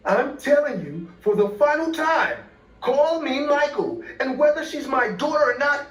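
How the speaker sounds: high-pitched acted rant; angry screaming. A narrator's reading. Michael telling off the McDonald's worker, angry screaming. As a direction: rage at him, pitch right up.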